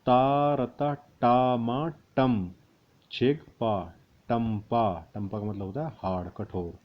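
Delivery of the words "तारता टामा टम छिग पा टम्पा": drawn out syllables; slow spelling out. Shown as a demonstration. Teacher spelling letters slowly for students. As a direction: sound each part out slowly. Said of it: "उसके बाद देखें".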